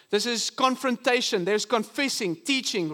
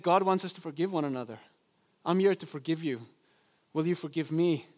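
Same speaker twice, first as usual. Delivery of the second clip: imitating her soft, low-toned offer; quiet, tender imitation of a peacemaker; gentle forgiveness; 5 semitones lower, 4 dB quieter than usual. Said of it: "But her tone of voice".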